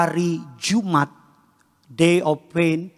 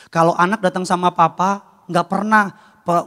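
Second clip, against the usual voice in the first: ordinary talk, nothing marked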